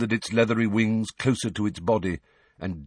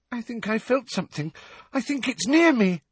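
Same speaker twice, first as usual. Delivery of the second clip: frightened. A narrator's reading. Parvati scared of unseen horse.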